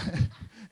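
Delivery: real chuckle mid-sentence; amused laugh. Real, not acted. Preacher about to share a family dinner story, amused chuckle. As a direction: laugh to yourself before a funny story.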